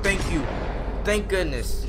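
ordinary delivery

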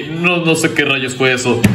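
normal talking voice

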